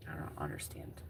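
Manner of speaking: whispered confusion; quiet unvoiced whisper. Real, not acted. Whispered aside.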